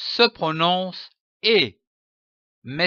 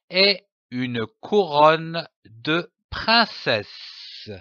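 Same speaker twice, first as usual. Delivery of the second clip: read syllable by syllable. Shown as a demonstration. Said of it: "phrase découpée en syllabes".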